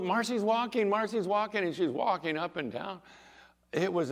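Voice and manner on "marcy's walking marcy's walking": high, loud, amazed announcement; raised, excited repeated cry; excited calling out. Retold or imitated. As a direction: imitate a nurse calling out the news. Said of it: "runs down to the nurse's station and calls".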